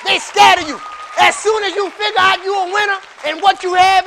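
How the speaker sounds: loud, high shouted preaching; full-force preaching. Acted. Preacher shouting over the congregation, full force. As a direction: whip the church up, shouting.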